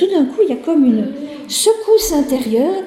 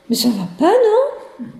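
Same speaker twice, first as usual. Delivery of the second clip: mock indignant priest; 6 semitones higher than usual. Priest scolds the couple.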